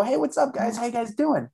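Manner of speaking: high, bright paparazzi hello; friendly greeting. Retold or imitated. Paparazzo greeting the couple at the airport, high and cheerful. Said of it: "I go".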